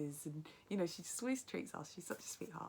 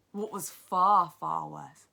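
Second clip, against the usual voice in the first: "far" much louder and slow, hammered twice; loud stress; 15 dB louder, much slower than usual. A narrator's reading. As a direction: punch "far, far worse" out loud. Dramatic read-aloud build.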